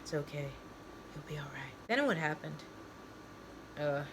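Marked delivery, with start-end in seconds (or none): 0.0-1.7 low, hushed near-whisper; soft whispered reassurance. A narrator's reading. A voice entering his head, soft whispered reassurance. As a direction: reassure very softly, under the breath. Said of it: "a whisper enters my head".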